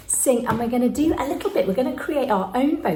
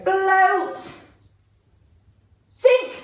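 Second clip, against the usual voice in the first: calling commands; 8 semitones higher than usual. Shown as a demonstration. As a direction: call it out brightly to the room.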